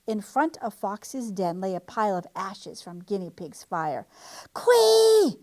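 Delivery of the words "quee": high, loud howl of a furious fox. A narrator's reading. Fox howling his prey's name in rage. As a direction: fling the name out in a long howl. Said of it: "howled Fox".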